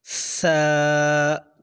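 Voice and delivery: सा with the tongue between the front teeth, air flowing out; airy th sa. Shown as a demonstration. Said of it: "जैसे हवा निकलती है ना".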